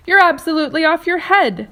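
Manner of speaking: loud, high scornful outburst. A narrator's reading. Earthworm scoffs at James's plan, loud and high. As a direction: sneer it out at full volume.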